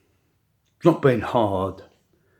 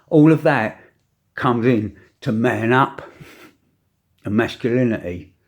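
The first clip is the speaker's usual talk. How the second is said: ordinary talk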